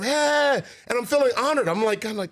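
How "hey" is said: high, drawn-out cheering "hey"; imitated crowd cheer. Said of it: "It went from".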